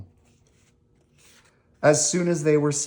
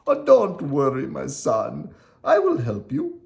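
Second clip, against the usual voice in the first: lightly voiced old father, rising high; reassuring Geppetto; 4 semitones higher, 4 dB louder than usual. Geppetto finding Pinocchio in the cage, reassuring. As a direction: comfort your son through the bars. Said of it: "said Geppetto".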